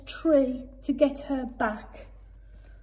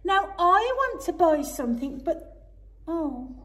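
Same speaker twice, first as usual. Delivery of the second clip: sadly; 7 semitones higher than usual. Acted. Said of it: "he said sadly".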